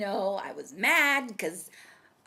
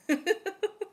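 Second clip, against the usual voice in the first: high giggling fit; giggle; 8 semitones higher than usual. Real, not acted. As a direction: break into a helpless little laugh. Breaks up laughing mid-story.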